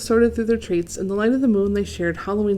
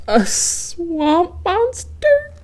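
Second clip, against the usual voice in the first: high, slow frightened question; a timid goose squeaking in alarm; scared question; 11 semitones higher, 4 dB louder, much slower than usual. A narrator's reading. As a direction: stretch it out in fear. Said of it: "he asked".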